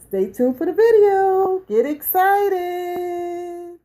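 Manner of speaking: loud, high, full-energy shouting; shouted hype-woman opener, ending on a long sung-out note; hyped-up channel intro. Acted. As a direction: pump up the crowd at full blast.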